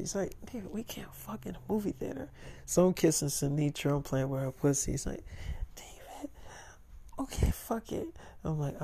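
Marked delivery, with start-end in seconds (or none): 0.5-2.3 very soft, breath-light objection; secretive near-whisper; hushed protest. Acted. Objects secretly in movie theater. 5.8-8.1 breathy, voiceless whisper; very soft acted whisper; whispered giving-in. Gives in, whispering in theater.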